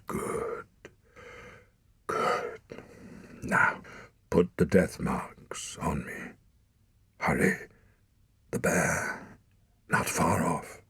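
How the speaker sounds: slow, strained whisper of a wounded hunter; dying father. A narrator's reading. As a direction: spend his last breath on the warning. Dying father's last orders.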